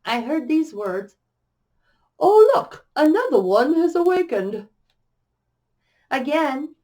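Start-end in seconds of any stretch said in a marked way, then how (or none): 2.2-4.7 high-pitched, loud British chime; sing-song British accent, ringing out. A narrator's reading. Same voice heard on sitting up again, melodic British accent. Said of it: "low female voice in what sounded like a British, British accent chime".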